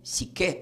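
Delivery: "psique" with the accent on the final E; stress on final E. Shown as a demonstration. Teacher showing the Greek word, "psique" stressed on the last syllable. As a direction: say "psique" as si-KE, slowly. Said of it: "con acento en la E".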